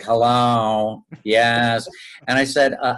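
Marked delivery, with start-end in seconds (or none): 0.0-1.9 drawn-out mimicry of a casting director; imitating casting director. Actor mimicking the casting director's hello, imitation. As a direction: mimic how he answers the phone. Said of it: "he talked like that. He was like".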